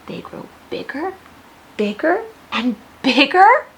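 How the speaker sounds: from a whisper up to a loud call; growing from hushed to big; building bigger. Acted. Each bigger said bigger.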